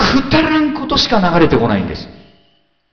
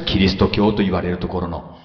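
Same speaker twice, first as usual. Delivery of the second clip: usual speaking voice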